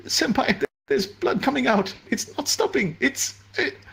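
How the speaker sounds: high, frightened pleading; panicked. Acted. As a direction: near tears at the blood. Junior panics over the bleeding.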